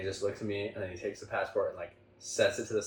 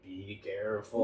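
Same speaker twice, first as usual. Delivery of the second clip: put-on ominous old man; 4 dB quieter, slower than usual. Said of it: "the old man is like".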